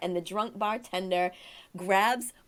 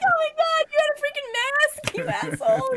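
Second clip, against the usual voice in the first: high, loud retold outburst; outraged exclamation; 5 dB louder than usual. Outraged retold reaction to husband's mask.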